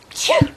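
a high, sharp acted sneeze. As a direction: sneeze it out big. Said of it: "made Koketso sneeze".

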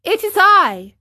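high, loud puppet voice; marionette calling up. Acted. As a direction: answer up to the window. Marionette answers up to window.

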